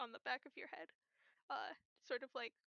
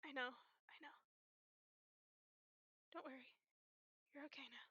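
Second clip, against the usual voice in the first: tender comforting; 7 dB quieter, faster than usual. Acted. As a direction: comfort a friend in a hug, softly. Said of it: "and he just goes".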